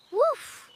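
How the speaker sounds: two high little woofs; puppy woof. Acted. Puppy answers a child calling it over, high woofs. Puppy called a good friend.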